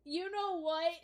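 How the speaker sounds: high, loud laughing. Real, not acted. GM cracking up at the players' joke.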